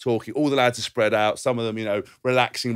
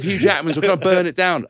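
high, loud mock threat; retold angry threat; 8 semitones higher, 5 dB louder than usual. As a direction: act out an over-the-top threat.

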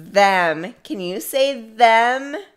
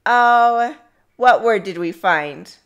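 ordinary talk, nothing marked

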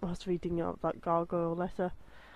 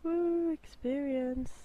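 drawn-out cheer; 6 semitones higher, much slower than usual. Real, not acted. Cheering the reward.

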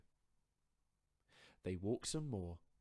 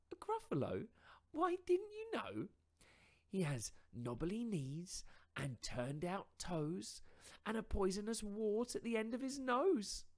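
higher, louder mouse voice, spinning a tall tale; boastful little mouse; 13 semitones higher, 7 dB louder than usual. Acted. Mouse inventing a monster to frighten fox, higher and louder.

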